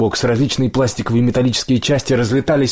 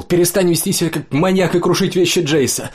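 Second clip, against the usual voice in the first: high-pitched, urgent scolding; acted shout; 8 semitones higher than usual. A narrator's reading. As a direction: scold him in a raised pitch. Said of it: "крикнул Коул".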